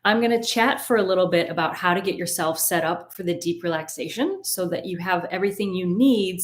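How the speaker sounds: ordinary delivery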